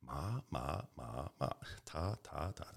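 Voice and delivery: "ma" and "ta" softly recited with changing tones; soft "ma", "ta" repeats, each bent differently; tone drill. Retold or imitated. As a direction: imitate a language tape cycling tones on "ma" and "ta". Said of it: "doing the tones".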